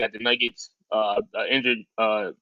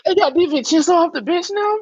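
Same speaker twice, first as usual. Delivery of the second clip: high, loud disbelieving question; can't believe what he's hearing; squeaky shocked outburst, pitched way up; incredulous; 6 dB louder than usual. Real, not acted. Disbelief at Warriors' signing.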